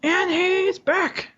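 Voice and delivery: drawn-out high announcer call; slow, high game-show reveal. Pretend. Announcer-style welcome back.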